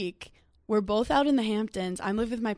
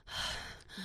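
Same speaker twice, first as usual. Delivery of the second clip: breathy sigh; 12 dB quieter than usual. Shown as a demonstration. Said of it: "just, like, a breath".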